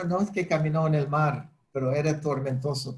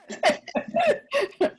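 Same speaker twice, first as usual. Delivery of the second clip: real laugh. Real, not acted. Laughs at own joke.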